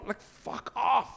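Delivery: slow, high, soft dismissive scoff; dad scoffing at daughter; drawn-out fuck off. Retold or imitated. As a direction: scoff it out slowly. Dismissive retort.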